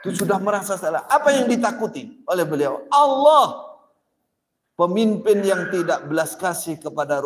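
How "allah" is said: Allah called out loud and high; emphatic cry. Real, not acted. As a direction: ring out the name at the peak of the sermon. Preacher's climactic answer.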